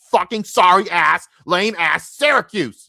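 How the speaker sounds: a slow bitter stretched insult; mad ranting drawl. Real, not acted. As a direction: spit out the team name, still mad. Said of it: "Sorry, I'm still mad".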